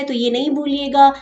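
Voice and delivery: normal talking voice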